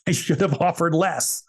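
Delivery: high, slow self-annoyed groan of regret; mock-scold yourself for leaving money on the table; kicking himself. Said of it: "I walked away going".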